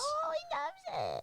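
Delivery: very high, slow, soft doggy voice. Real, not acted. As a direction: owner coos softly at the dog, very high and slow. Baby talk to the dog.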